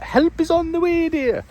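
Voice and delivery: put-on very high falsetto old lady; Mrs Doubtfire impression. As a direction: sing it out like a fussy old nanny. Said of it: "scene out of Missus Doubtfire".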